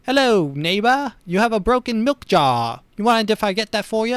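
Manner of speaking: put-on high, chipper British cop accent, lightly done; a hint of a helpful British constable; British bobby voice. Friendly British beat cop offering help, lightly British. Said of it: "I don't know why he's British".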